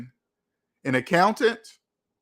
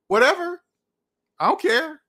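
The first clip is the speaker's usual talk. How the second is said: high-pitched, louder brush-off; dismissive; 11 semitones higher, 4 dB louder than usual. Acted. Host brushing off each country's different paper money, dismissive. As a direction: toss it away in a raised voice.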